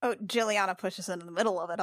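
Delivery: ordinary talk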